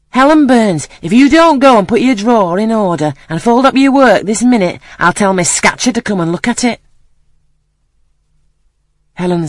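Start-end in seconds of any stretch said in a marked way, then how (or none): 0.1-6.8 loud, high Cumberland exclamation; strong Cumberland accent. A narrator's reading. Rough girl monitor scolding Helen Burns, strong Cumberland accent. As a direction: call out sharply in broad Cumberland. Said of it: "exclaiming in a strong Cumberland accent".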